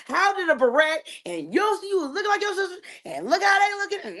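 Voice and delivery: high, fast mock-scolding of a daughter's hair; exasperated mom. Mother scolding daughter about her messy picture-day hair, high and exasperated. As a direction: squeal at her like a mom who can't believe it.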